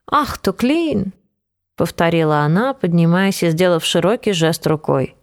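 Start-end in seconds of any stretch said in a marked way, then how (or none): 0.1-1.1 slow, high, exaggerated delight; mock delight. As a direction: ham up the fake enthusiasm.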